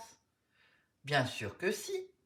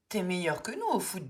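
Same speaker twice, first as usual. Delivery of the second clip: ordinary delivery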